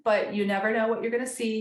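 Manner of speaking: usual speaking voice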